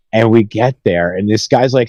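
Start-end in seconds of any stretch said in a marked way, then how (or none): none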